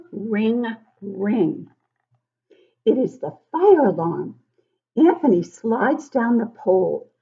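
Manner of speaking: lively storybook read-aloud; reading to children. Acted. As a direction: read it like story time for little kids. Teacher reads picture book aloud to children.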